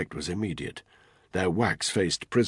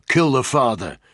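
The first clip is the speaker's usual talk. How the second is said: loud, raised-pitch command; shouted order; 5 semitones higher, 6 dB louder than usual. A narrator's reading. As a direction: shout it down the phone. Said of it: "he shouted into it".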